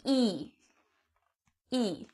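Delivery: quiet E with lips spread wide. Shown as a demonstration. Teacher shows the class a quiet, spread E. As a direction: say E slowly with the mouth spread. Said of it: "watch my mouth".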